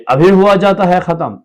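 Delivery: high and loud; impatient shout. Acted. Wife snapping at her husband that the letter is nearly done, loud. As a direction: snap back impatiently.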